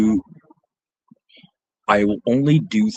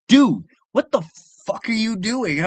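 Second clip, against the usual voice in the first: much higher mock-exasperated outburst; exasperated retold scolding; 12 semitones higher, 5 dB louder than usual. Bodyguard scolding his client over soggy money, exasperated. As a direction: hit "Dude" high and loud, imitating disbelief.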